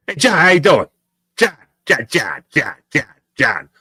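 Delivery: mock kid calling his brother; dad as a kid. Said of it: "turns into an eleven-year-old kid from Ambler".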